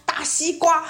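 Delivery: high excited blurt; mock silly answer. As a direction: blurt it out playfully. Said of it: "我就说".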